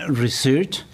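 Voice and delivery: normal talking voice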